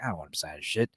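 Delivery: low, soft retold grumble to self; under-the-breath recalled thought; muttered aside. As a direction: mumble it low, as if to yourself.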